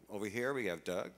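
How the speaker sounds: ordinary talk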